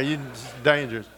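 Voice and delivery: ordinary talk, nothing marked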